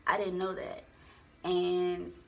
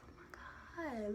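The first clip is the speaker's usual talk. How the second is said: whispered shock; 3 semitones higher, 10 dB quieter, slower than usual. Retold or imitated. Retelling being thrown to floor.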